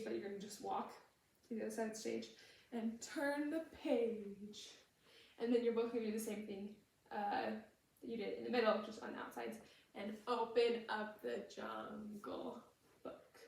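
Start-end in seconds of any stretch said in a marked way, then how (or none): 2.7-4.7 slow and drawn out, page held long; slower take. Shown as a demonstration. Choreographer cueing Baloo's walk across stage, slowly drawn out. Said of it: "you say it a little slower". 10.0-13.4 slow, measured, syllables stretched; slower take. Shown as a demonstration. Choreographer showing both dancers the ending, slowly spaced out. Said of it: "you say it a little slower".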